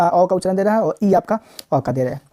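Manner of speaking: usual speaking voice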